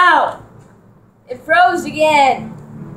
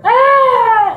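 startled yelps; 11 semitones higher, 5 dB louder, much slower than usual. Real, not acted. Hit in game, real yelps.

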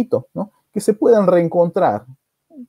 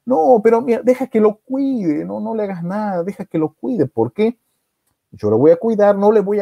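high, urgent acted begging; pleading; 6 semitones higher than usual. Sister begging brother to let her keep the baby, pleading. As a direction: plead in a raised voice.

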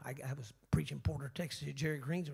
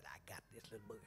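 low, soft, scheming thought; a preacher thinking he's caught a man in his own words; inner voice; 7 semitones lower, 16 dB quieter, much faster than usual. Retold or imitated. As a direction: say it low like a private plan. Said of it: "And in my mind, I said".

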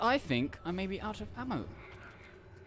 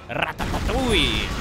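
mock battle cry; 3 semitones lower, 7 dB louder than usual. Mock-shouting a cartoon word at monster.

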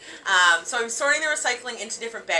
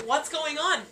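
high, concerned mom question; lightly voiced worried mother; 7 semitones higher, slower than usual. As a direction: ask like a mother alarmed. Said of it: "she's like".

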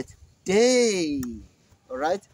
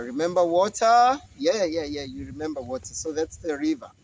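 usual speaking voice

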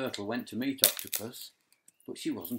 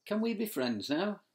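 hopeful; 10 semitones higher, 4 dB louder than usual. Acted. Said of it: "Shark smiled".

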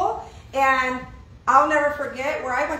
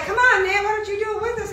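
higher-pitched coaxing of a pushy friend; pressuring friends; 7 semitones higher, faster than usual. Acted. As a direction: egg a buddy on to join in. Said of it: "They're like".

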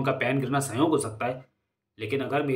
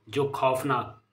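ordinary delivery

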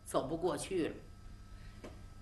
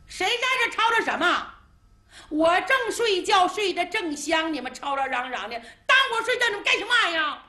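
high, loud, fast grumble of someone just woken; a stranger rising from behind a rock, annoyed; grumpy woken voice; 8 semitones higher, 4 dB louder, faster than usual. Acted. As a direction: complain loudly about being woken. Woken old man complains.

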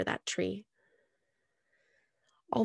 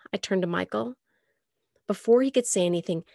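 ordinary delivery

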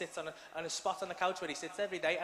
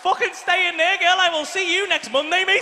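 loud, high call across the room; shouting; 10 semitones higher, 12 dB louder than usual. Real, not acted. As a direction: shout it out, half joking. Shouting off to someone.

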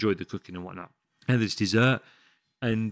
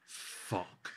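whispered panic; 10 dB quieter than usual. Retold or imitated. Panic on seeing boss.

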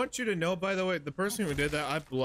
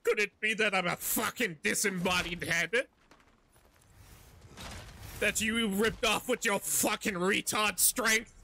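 slow, higher mocking shout; hammed-up furious Helios; sarcastic Helios; 4 semitones higher than usual. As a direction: drip sarcasm as the angry head.

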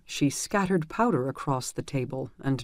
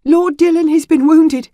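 much higher, much louder rushed outburst; blurted out; 12 semitones higher, 15 dB louder than usual. A narrator's reading. A panicked squire blurting bad news to the healer. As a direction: blurt the news out in one burst. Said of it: "he blurted".

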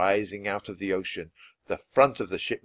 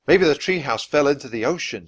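raised, higher worried voice; shouting; 5 semitones higher, 5 dB louder than usual. A narrator's reading. Jack to Annie about the missing treehouse, shouting. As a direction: call it out over the noise. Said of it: "shouted Jack".

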